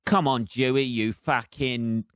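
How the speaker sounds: ordinary delivery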